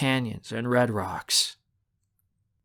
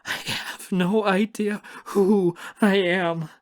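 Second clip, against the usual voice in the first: slow, high wail; tearful terror; 8 semitones higher, 3 dB louder, slower than usual. A narrator's reading. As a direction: drag it out through panicked sobs. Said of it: "I look around me in absolute terror".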